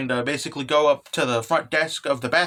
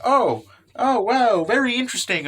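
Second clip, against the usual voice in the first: higher, intrigued "oh wow"; mock amazement; 7 semitones higher, 3 dB louder than usual. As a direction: play a character marveling at a discovery. Acting a curious character's discovery.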